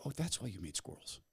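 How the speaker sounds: hushed, wondering discovery; quietly amazed, as it clicks; a gentle breath of wonder; soft awed realization. Pretend. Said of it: "you go".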